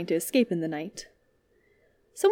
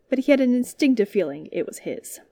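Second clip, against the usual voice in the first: ordinary delivery, nothing marked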